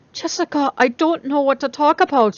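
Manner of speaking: anxious burst of words. A narrator's reading. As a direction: blurt it out all at once. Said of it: "she burst out suddenly".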